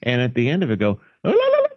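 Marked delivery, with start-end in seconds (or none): 1.2-1.7 very high trilled ululation; ululating rally call. Acted. Mocking a news sign-off, ululating rally call. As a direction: let out a high, mock ululating cry. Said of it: "like the Taliban rally call".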